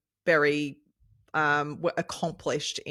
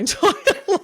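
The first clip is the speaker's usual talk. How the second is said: laughing; 8 dB louder, much faster than usual. Retold or imitated. Retelling the race to Damien.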